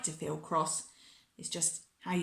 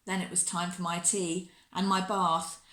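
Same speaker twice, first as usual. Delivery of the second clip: ordinary delivery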